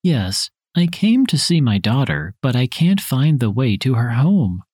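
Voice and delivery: ordinary talk, nothing marked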